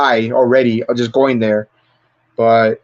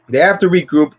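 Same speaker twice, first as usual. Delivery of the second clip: ordinary delivery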